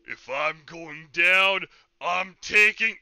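slow booming cartoon villain; stupid supervillain voice. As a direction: ham it up as a doomed supervillain. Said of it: "In a stupid supervillain voice".

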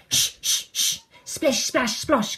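crisp shushes into bouncy splashes; shaker and splashes. Acted. Sylvie playing her sounds, crisp playful shushing. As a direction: imitate a shaker, then pebbles in water. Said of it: "goes Sylvie".

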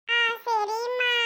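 put-on high, slow child's reply; obedient little child. Child agreeing with mother, obedient. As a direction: agree sweetly, drawn out like a child.

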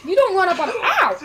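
high, loud shout cut by pain; angry yell. Real, not acted. Gamer yells at an orc that hits him. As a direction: yell then yelp.

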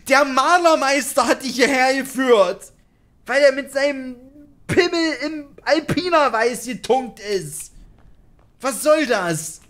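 high, loud mock outrage; exasperated rant. Player ridicules the painter who led him here, exasperated rant. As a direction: rant upward in disbelief, drawing out the last words.

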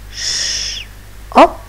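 hushed sh then a bright up; sounding out shop. Shown as a demonstration. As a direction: split "Sh-" and "up" apart. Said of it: "listen and repeat after me".

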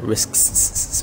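Risks ending in a long sibilant; emphasised S. Shown as a demonstration. Gamer pointing out his habit, Risks with hissed S. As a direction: stretch out the final S in Risks. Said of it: "put an emphasis on the S".

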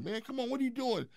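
high-pitched imitation of worried onlookers; pleading bystanders. Onlookers trying to stop a man with a rifle, pleading.

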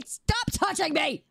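high, whiny telling-off; annoyed protest. Real, not acted. Streamer telling a pest to stop touching, annoyed. Fending off someone poking them.

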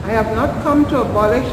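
normal talking voice

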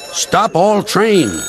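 slow, high alarm call; urgent shout. Acted. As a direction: shout the warning.

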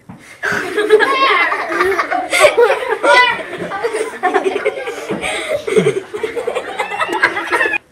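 long high laughter; laughing. Real, not acted.

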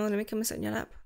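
ordinary talk

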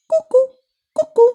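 high, loud kuku; cuckoo call. A narrator's reading. Cuckoo calling out kuku, high. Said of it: "Zakukała".

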